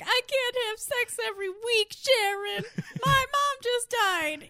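high, strained mock complaint; upset outburst. Client protests to Sharon about his mom, upset outburst. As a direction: plead it high, stretching the words.

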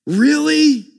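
drip with sarcasm; sarcastic wow. Retold or imitated. Healed man mocking the Pharisees, sarcastic. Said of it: "responds sarcastically and says".

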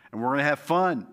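ordinary talk, nothing marked

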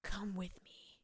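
hushed, soft, mock-soulful invitation; emotional whisper. Retelling the film's soul-searcher inviting her along, whispered. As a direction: whisper it low, as a self-serious romantic. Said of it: "he is portrayed at this, like, very, like, emotionally".

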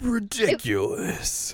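high, very drawn-out cartoon villain; mock villain. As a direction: stretch it out theatrically, pitched high. Continuing villain imitation.

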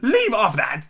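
high, mildly shrieked order; screamed. A narrator's reading. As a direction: scold as a screaming queen. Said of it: "screamed the Queen".

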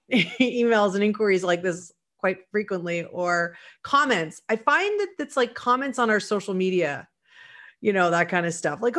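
ordinary talk, nothing marked

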